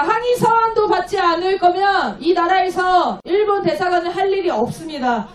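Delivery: ordinary talk, nothing marked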